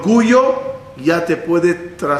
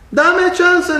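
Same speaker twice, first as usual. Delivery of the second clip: high, eager cocky dare; cocky boast; 13 semitones higher than usual. Acted. A gambler daring his friend, eager and cocky. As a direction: brag that he can do it.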